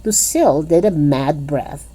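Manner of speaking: plain reading voice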